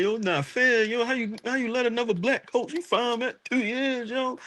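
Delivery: high, whiny imitation of a complainer; mocking whiny complaint. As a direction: whine it up high like a sore loser.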